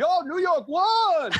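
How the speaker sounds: drawn-out high hollering fan; excited stranger yell. Retold or imitated. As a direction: yell it like a thrilled passerby. Stranger yelling from behind.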